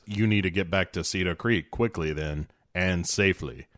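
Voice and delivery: a hint of a calm, grave commander; mock Morgan Freeman.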